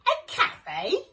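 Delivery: high, loud surprised gasp. Acted. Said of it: "gasped Shifty".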